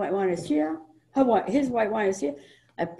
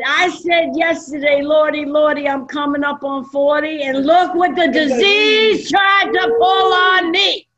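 preaching shout, higher and louder; retold shout; 7 semitones higher, 6 dB louder than usual. Speaker tells the home group what happened, shouting. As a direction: proclaim it to the group.

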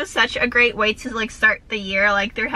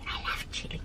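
a breathy whispered "I love chili"; a soft secret confession of love for chili; 16 dB quieter than usual. Real, not acted. Whispered repeat.

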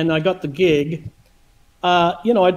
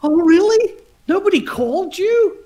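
high surprised oh really; shocked; 11 semitones higher than usual. Retold or imitated. Chick learns nobody phoned him, shocked and high. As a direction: sound taken aback, pitched high. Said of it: "And he was shocked".

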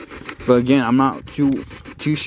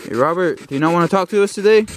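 calling out to a ghost; 5 semitones higher than usual. Real, not acted.

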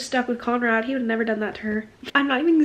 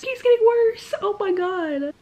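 exasperated; 9 semitones higher than usual. Real, not acted. Exasperated reaction.

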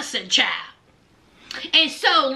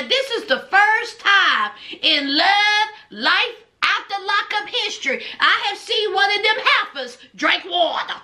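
ordinary talk

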